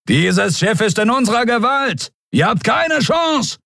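high, commanding yell, slowing at the end; shouted threat. A narrator's reading. Attacker to cornered crew, shouted threat. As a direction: proclaim victory across the battle.

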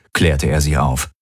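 usual speaking voice

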